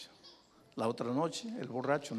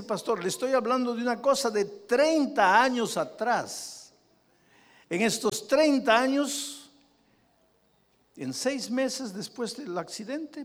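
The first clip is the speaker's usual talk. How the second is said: loud preaching; 8 semitones higher, 8 dB louder than usual. Acted. Preacher at full force.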